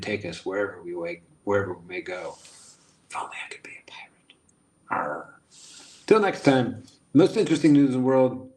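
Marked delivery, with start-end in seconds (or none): 3.1-4.3 quick hushed whisper; wistful whisper. Real, not acted. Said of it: "whispered wish". 4.9-5.4 pirate arr. Pretend. Pirate growl.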